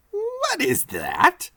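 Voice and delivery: a tiny bent doorkeeper amused; odd little man. Acted. Bartok Wynn asks.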